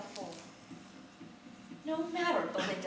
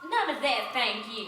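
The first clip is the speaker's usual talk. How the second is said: snapped; 8 dB louder than usual. Acted. Belinda cutting off the singing guests, a loud sharp snap. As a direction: snap it at the singers to shut them up. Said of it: "Belinda Kite snapped".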